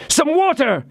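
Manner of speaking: high, urgent cry for water. Acted. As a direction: shout for water in panic. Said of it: "cried D'Artagnan".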